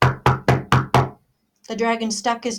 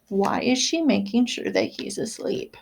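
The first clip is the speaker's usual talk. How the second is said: usual speaking voice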